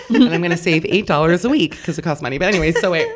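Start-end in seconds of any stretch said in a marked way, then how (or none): none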